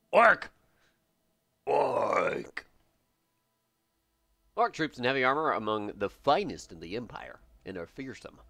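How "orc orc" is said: mock orc bark. Announcing race in mock voice.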